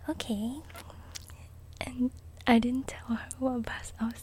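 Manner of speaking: gentle whispered storytelling; soft-spoken ASMR. Real, not acted. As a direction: whisper softly, like a bedtime chat.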